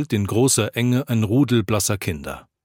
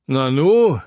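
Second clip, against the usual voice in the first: high, louder exclamation of surprise; astonished; 4 semitones higher, 6 dB louder than usual. A narrator's reading. Drinker taken aback by the pastor's denial, astonished. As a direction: swoop up in loud astonishment. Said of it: "sagt einer erstaunt".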